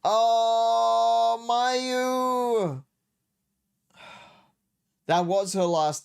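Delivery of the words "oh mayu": high, drawn-out call of a mother. Acted. A mother calls her daughter back, long and high. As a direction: stretch the name out like a mom calling from the door.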